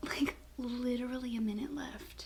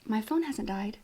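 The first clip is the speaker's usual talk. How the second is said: ordinary talk, nothing marked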